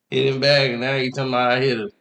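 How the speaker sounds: ordinary delivery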